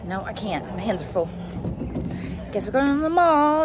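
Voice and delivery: ordinary delivery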